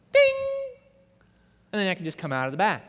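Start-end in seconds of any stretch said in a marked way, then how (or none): 0.1-0.8 high sung ding like a shop bell. Retold or imitated. Imitating the bell over the shop door, a high ding. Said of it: "It goes".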